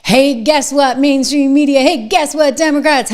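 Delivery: loud gloating call-out; brash victory taunt; taunting shout. Acted. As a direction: rub it in their faces. Taunting opponents.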